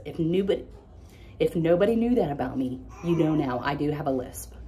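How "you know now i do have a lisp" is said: ordinary delivery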